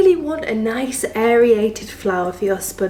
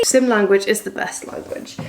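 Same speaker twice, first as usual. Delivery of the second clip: ordinary delivery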